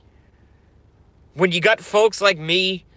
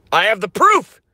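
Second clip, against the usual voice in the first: triumphant shout; 5 semitones higher, 4 dB louder than usual. Acted. Ranting conspiracy character shouting.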